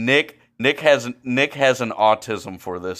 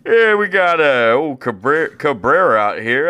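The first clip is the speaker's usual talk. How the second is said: mock old announcer; 4 semitones higher, 6 dB louder than usual. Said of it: "I'm Mike Shannon".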